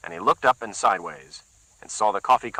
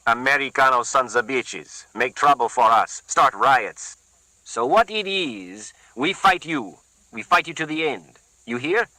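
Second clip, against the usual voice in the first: put-on thick Puerto Rican accent; 4 semitones higher, slower than usual. A narrator's reading. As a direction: warn him off in a heavy Puerto Rican accent. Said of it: "less pronounced accent than the other".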